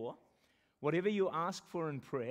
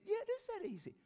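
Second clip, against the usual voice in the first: high, softer voicing of God's answer; God's reply; 5 dB quieter than usual. Pastor voicing God's reply to him, high and light. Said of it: "He said".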